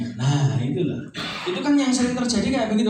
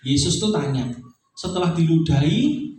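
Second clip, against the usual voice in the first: normal talking voice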